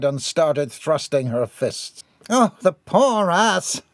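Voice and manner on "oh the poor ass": high, slow lament; dismayed onlooker. A narrator's reading. John Adams covering his eyes, dismayed cry. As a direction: wail it in pity.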